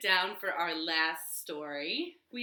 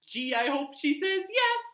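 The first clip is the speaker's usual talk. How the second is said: hopeful pig; 6 semitones higher, 5 dB louder than usual. Acted. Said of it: "thought Mister Pig".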